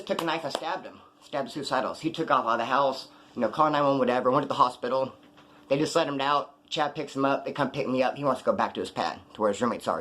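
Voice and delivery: ordinary delivery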